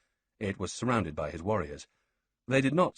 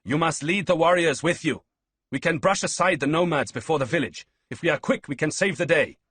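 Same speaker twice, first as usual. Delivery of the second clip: loud, high call to the roof; 9 semitones higher, 8 dB louder than usual. Acted. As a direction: shout up to the tower top. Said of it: "Ballista called up".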